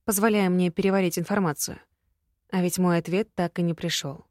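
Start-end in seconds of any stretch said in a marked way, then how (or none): none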